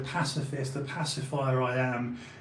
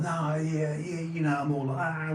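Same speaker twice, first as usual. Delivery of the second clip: apologetic; 3 semitones higher than usual. Retold or imitated. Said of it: "Mister Apologetic".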